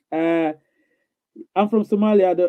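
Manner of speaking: ordinary talk, nothing marked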